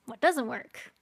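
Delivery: normal talking voice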